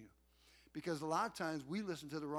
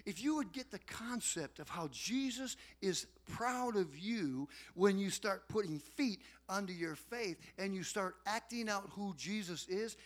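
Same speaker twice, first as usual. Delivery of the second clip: ordinary talk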